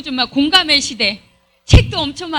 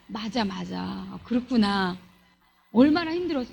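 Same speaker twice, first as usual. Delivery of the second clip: gentle comforting; 6 semitones lower, 5 dB quieter than usual. Acted. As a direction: comfort a friend who is hurting, softly. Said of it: "그대로 수용해주는 사람".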